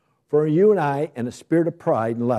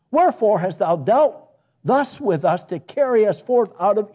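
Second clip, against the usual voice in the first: scripture reading; 8 semitones higher, 3 dB louder than usual. Acted. Reads scripture aloud.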